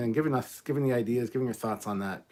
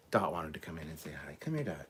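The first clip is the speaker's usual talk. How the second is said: whisper; 5 semitones lower, 5 dB quieter than usual. Real, not acted. Whispering at the dog.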